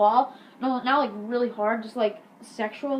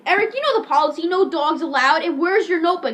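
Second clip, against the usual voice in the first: louder, higher mock telling-off; scolding teacher; 5 semitones higher, 7 dB louder than usual. Teacher scolds Eric over the dog, mock scolding. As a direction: imitate a teacher laying down the rules.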